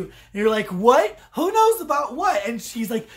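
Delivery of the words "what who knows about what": high, loud, incredulous question; acted bafflement. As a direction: blurt it out like a baffled viewer. Acting out a baffled viewer.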